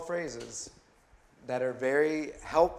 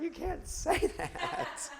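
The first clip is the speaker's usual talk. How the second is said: high, drawn-out mock protest, cracking into a laugh; indignant wife; 4 dB quieter, much slower than usual. Upset wife objecting to his apology, mock indignant. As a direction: imitate an exasperated wife, voice shooting up high.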